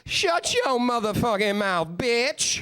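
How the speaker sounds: hammed-up angry subway lady; imitating a furious lady.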